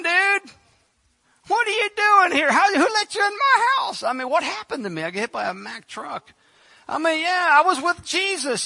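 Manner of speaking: high, loud, indignant complaint; annoyed Tabitha. Retold or imitated. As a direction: scold him for dragging you back. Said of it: "You know what I think she did?".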